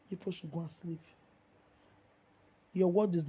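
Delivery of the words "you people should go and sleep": soft, dropped-down dismissal; quiet repeat. Real, not acted. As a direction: say it again under the breath, softer. Repeats dismissal to critics, softly.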